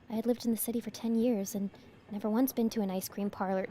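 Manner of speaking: quiet whispery story reading; soft ASMR narration. Acted. Reads game text in ASMR voice.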